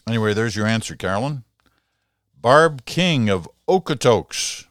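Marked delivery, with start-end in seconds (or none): 2.3-4.7 announcing a name. Real, not acted. Host announces letter writer's name.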